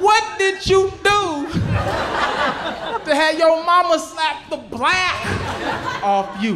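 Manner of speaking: loud, high, drawn-out mock outrage; incredulous yelling. Kid confronting albino classmate about mama's slap, incredulous yelling. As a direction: holler it slowly in comic disbelief.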